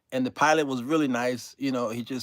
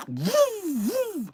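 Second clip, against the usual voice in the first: high mock engine woofs; engine noise imitation. Mimicking the sputtering plane engine, high puffs.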